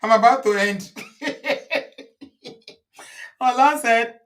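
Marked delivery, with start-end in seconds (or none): 0.9-2.7 helpless airy giggle; breathy laugh. Real, not acted. Host cracking up at a viewer's comment. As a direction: laugh in spite of trying not to. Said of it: "please don't make me laugh".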